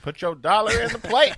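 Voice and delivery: high, loud pulpit call; rising impassioned oratory; preacher voice. Acted. Continues preacher bit.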